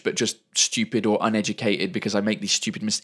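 ordinary talk, nothing marked

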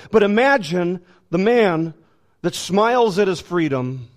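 ordinary delivery

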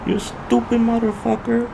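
high mock yell in a Mexican accent; high shouted Mexican-accented insult. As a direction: imitate the angry driver with a Mexican accent. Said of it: "How did he sound?".

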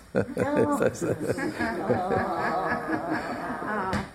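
long high laugh; real sustained laughing; laughter. Real, not acted. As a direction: laugh heartily after the punchline. Laughs at own punchline.

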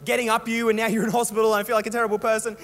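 imitating mum apologising to her son in hospital; guilty mum. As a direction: play mum full of remorse. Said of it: "She's like".

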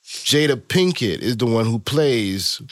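ordinary delivery

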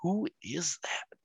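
slow hushed whisper, like in a dark theater; whispered wondering aside. Retold or imitated. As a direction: breathe the question under your breath, slowly. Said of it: "And then I'm like".

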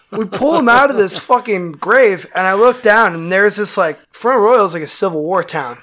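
ordinary delivery, nothing marked

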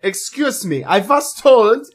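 put-on slow, affronted German-accented complaint. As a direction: protest stiffly and slowly in a thick German accent. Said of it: "impressions of my accent".

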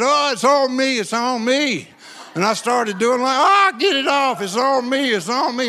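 flail as if a demon's on your hand; mock panic.

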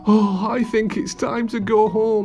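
high, plaintive character voice; worrier's voice. A narrator's reading. Relieved Mister Worry out of the pyramid, high plaintive voice.

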